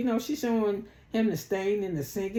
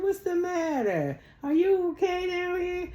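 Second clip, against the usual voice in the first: imitating Angela; 11 semitones higher than usual. Mimicking Angela asking the women if they are okay, high and sweet. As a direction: put on a high, sugary voice. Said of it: "She said".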